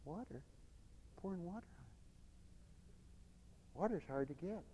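very soft, under the breath; puzzled onlookers. Acted. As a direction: quietly question it to yourself.

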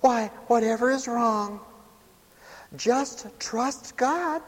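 high, put-on voice of pious advice; mock a smug comforter, drawing out the end; rub-it-inner. Rub-it-inner advises the despised lamb, high put-on mock concern. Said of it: "By the rub-it-inners?".

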